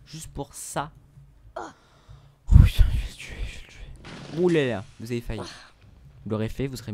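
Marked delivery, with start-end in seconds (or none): 1.6-4.4 hissed angry whisper; seething whisper at the enemy; whispered threat. Real, not acted. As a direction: whisper the threat through your teeth. Furious at game enemy, whispered.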